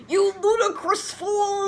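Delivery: loud furious hammed-up shout; angry shout. Dumbledore scolding the couple, loud angry shout. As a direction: roar it at them. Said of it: "kept shouting at us angrily".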